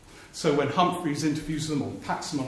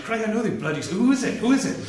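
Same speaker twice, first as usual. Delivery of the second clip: put-on impatient Welsh accent, higher and pressing; 7 semitones higher, 4 dB louder, faster than usual. Kinnock demanding the news in a mock Welsh accent. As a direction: jab at the question in a Welsh lilt. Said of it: "sorry about the Welsh accent".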